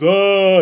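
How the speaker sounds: long, high, loud pretend sob-cry; mock crying wail. Showing how he'll cry heavy tears, loud mock wail. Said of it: "I cry like heavy tears".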